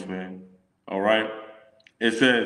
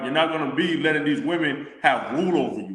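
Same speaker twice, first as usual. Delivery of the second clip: ordinary delivery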